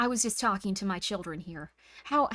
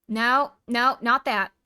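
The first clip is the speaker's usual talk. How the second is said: mock stern correction; 10 dB louder, slower than usual. Correcting chat's compliment loudly.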